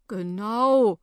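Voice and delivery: put-on loud, emphatic old man's agreement; older gentleman. A narrator's reading. Older man backs the indignant woman, emphatic and disapproving. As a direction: agree loudly as the grumbling elder. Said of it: "meint der ältere Herr".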